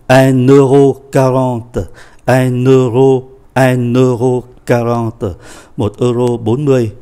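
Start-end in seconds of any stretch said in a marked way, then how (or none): 0.1-1.8 "un euro" run together with an n linking the words; slow "un euro quarante", sounding the n into "euro"; linked pronunciation. Shown as a demonstration. Teacher shows students how to link the price, linked pronunciation. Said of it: "chúng ta phải đọc nối". 2.2-5.3 "un euro" repeated as one joined unit, unhurried; linked pronunciation. Shown as a demonstration. Teacher repeats the price for students, linked pronunciation. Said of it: "chúng ta phải đọc nối".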